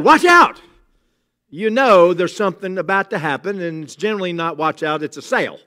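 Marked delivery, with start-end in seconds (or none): 0.0-0.6 high, loud shout; preacher yelling a warning to the congregation; yelled warning. Retold or imitated. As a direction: bark the warning out sharp. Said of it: "if I yell".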